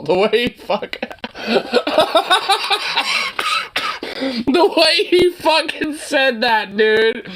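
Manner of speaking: words broken up by high laughter; can barely get the words out for laughing; laughing while talking. Real, not acted. Streamer cracks up at the politician's line, laughing through it.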